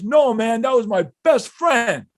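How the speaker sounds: loud, high acted denial; excited fan. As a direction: exclaim it as the excited guy, drawing out the end. Acts out the excited man's reply.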